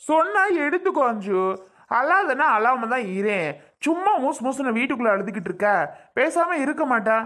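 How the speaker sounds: high-pitched, exasperated telling-off; angry scolding. Acted. Husband ordering crying wife to stop, angry scolding. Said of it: "ஏன் [name] இப்படிலாம் திட்டுறீங்க, கோவப்படுறீங்க?".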